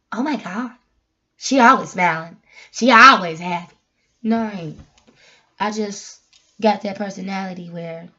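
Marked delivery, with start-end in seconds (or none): 0.1-3.8 mock exclaiming, louder on each "always"; imitating onlookers. Vlogger quoting people who look at her, mock exclaiming. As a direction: mimic people gushing, punching "always". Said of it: "they be like".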